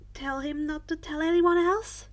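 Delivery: ordinary delivery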